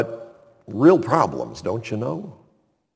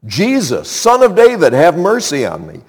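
higher, louder cry for mercy, imitating a blind beggar; acted loud plea; calling out loudly; 6 semitones higher, 6 dB louder than usual. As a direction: call out over a crowd, peaking mid-plea. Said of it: "He's calling out loudly".

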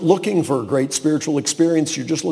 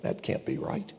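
under your breath, unvoiced; whispered doubt; 15 dB quieter than usual. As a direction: whisper the disbelieving thought. Inner doubting thought.

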